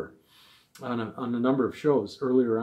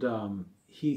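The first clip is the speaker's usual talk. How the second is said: ordinary talk, nothing marked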